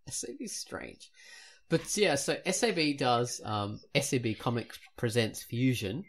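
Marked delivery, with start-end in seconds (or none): none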